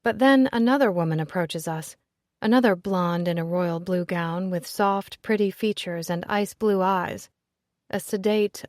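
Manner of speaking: ordinary delivery, nothing marked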